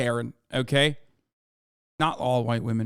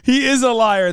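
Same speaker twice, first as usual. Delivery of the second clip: mock loud accusation; 8 semitones higher, 8 dB louder than usual. Repeats the clip line loudly.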